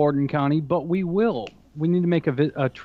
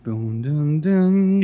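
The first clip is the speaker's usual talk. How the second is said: mock dramatic music; much slower than usual. Host mocking parents' warning with a music sting. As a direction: sing a cheesy warning sting. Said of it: "cue the music".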